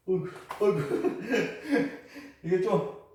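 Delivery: chuckling through a slip; real laughter breaking into the words; laughing. Real, not acted. Slipping on the floor, laughing. (미끄러져서 웃으며)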